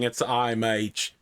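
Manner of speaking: normal talking voice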